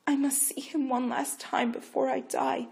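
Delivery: high pleading; a last wish begged out; weak plea. Acted. Rachel begging to see Jacob, weak. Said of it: "pleaded weakly".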